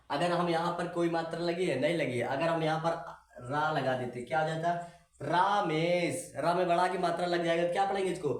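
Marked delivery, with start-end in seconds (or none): 5.2-6.3 रामेश with a long, drawn-out, weighted रा; रामेश, रा held long and heavy, slowly. Shown as a demonstration. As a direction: stretch the रा in रामेश, slightly heavier voice. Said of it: "हल्का भारी आवाज में पढ़ना".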